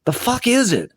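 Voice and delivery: very high-pitched exasperated question; annoyed demand. A narrator's reading. Niece on the floor questioning her uncle, exasperated. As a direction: demand an answer, voice jumping way up. Sarah on floor after tripping.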